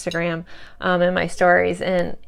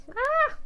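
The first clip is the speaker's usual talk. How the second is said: big sneeze; 5 dB quieter than usual. Real, not acted. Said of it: "I don't wanna sneeze".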